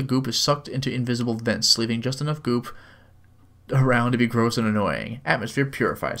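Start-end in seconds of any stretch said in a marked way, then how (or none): none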